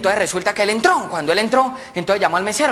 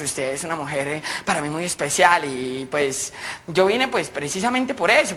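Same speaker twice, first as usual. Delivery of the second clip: soothing suitor; 4 semitones lower than usual. Acted. José shyly propositions Paola, soothing and confidential. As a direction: coax her gently and discreetly.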